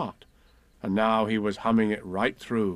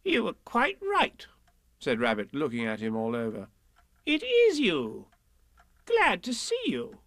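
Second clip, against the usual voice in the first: fussy, higher Rabbit voice, careful and polite; Rabbit's voice; 8 semitones higher than usual. Acted. Rabbit greets Pooh at home, fussy higher voice. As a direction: welcome the guest politely.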